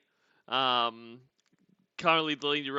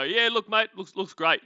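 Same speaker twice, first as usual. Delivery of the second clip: higher, louder mock praise; put-on encouragement, pitched up; feigned approval; 6 semitones higher, 6 dB louder than usual. Editors humouring the author about his book, feigned approval.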